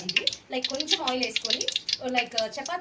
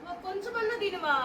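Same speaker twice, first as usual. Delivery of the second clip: high, slow, soft pleading; acted coaxing plea; 10 semitones higher, 7 dB quieter than usual. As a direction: coax them plaintively to eat a little. Staged coaxing to eat. (బతిమాలుతున్నట్టు నటిస్తూ)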